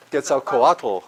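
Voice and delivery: Quetzalcoatl ending in a voiced tul; tourist-guide mispronunciation. Teacher mocking tourist guides' error, tourist-guide mispronunciation. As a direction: stress A and add a vowel before the final L in Quetzalcoatl. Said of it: "Tourist guides in Mexico City are notorious for this".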